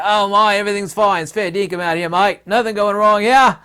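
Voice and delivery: high, loud hammed-up Australian accent; mocking a carefree Australian in a put-on accent; mock Aussie bloke. Perth local brushing off worries, hammed-up loud Australian. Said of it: "one in Perth was like".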